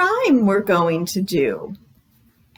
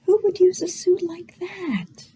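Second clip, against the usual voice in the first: hushed wondering whisper; 5 semitones higher, 4 dB quieter than usual. Acted. Librarian whispering a question to the kids. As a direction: ask it like a secret.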